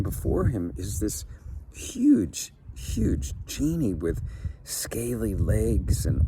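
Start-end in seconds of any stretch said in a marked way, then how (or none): 1.7-4.2 first HUGE flung high and loud, then slower; huge, huge, said in wonder; awed build-up. A narrator's reading. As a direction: stretch out the size of him. Narrator builds up the genie's size.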